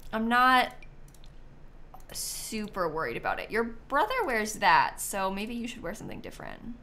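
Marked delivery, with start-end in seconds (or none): none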